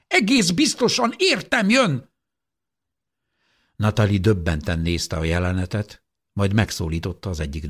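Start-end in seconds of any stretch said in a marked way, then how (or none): 0.1-2.1 desperate shout. Acted. Old woman fighting off nurse.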